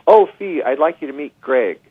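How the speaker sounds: high, loud gushing introduction; agent's excited intro. Retold or imitated. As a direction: gush like a Hollywood agent making an introduction. Agent introducing him at party.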